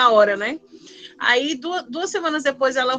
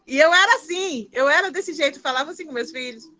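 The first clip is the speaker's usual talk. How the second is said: ordinary talk, nothing marked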